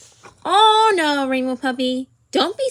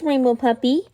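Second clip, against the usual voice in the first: ordinary talk, nothing marked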